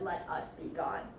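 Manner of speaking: low, soft reply; cold quiet echo. Acted. Montresor echoing his victim's plea, quiet and cold. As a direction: echo it back low and unmoved.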